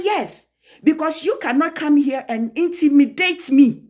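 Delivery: high, forceful retold rebuke; indignant comeback. Woman refusing to be intimidated by the husband, indignant. Retelling her angry comeback.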